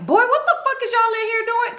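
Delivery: high-pitched mock outrage; angry uncle. Uncle comes out the room confronting them, mock-angry.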